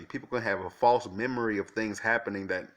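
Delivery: ordinary talk, nothing marked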